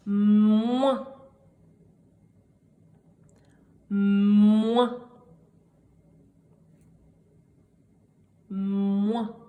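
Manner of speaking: "Moins" with a long hummed M before the vowel; dictated syllable. Shown as a demonstration. Teacher dictating a syllable three times, "Moins" drawn out. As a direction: stretch "Moins" out from a held M. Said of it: "je vais vous les répéter trois fois".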